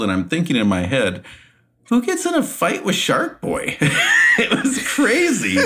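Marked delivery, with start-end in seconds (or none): 1.9-3.8 a much higher amazed question; disbelief, almost laughing; incredulous thought. Retold or imitated. Wrestler watching a locker room fight, amazed. 3.8-5.7 real laughter breaking out; laughing hard. Real, not acted. Telling the host about the Shark Boy fight, laughing.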